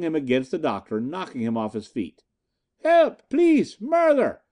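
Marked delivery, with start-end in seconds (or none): 2.8-4.4 very high, loud screams for help; screaming. A narrator's reading. Irishwoman crying murder at the intruders, full scream. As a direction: scream at the top of her voice. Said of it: "she screamed at the top of her voice".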